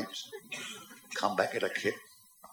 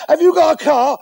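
put-on loud, high-pitched drunk question; high, boozy holler; drunken shout; 10 semitones higher, 12 dB louder than usual. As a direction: bellow it like a drunk in a bar. Drunk calling to his new friend.

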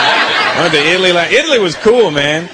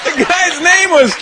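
high, drawn-out exclamation; amazed outburst; 11 semitones higher, slower than usual. Acted. Comic telling the crowd about the click, high drawn-out amazement. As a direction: shout it slowly in high-pitched disbelief.

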